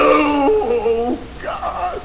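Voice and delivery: high cry dying away; anguished wail. Acted. Father cursed by his son in hell, anguished wail. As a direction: wail to God, fading out.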